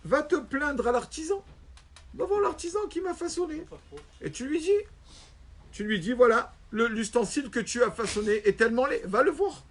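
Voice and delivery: high, indignant rebuke from the wronged man; high-pitched rebuking stranger; indignant ugly man. Acted. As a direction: tell him off in a high voice, go complain to your maker. Ugly man voiced rebuking rabbi.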